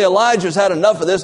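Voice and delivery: usual speaking voice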